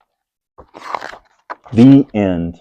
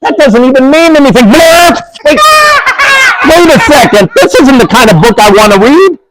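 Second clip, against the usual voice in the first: high, indignant fake outrage; mock protest; 8 semitones higher than usual. Grown-up reading to kids, faking outrage at the book. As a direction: play shocked you must read this. Reader pretends shock at book.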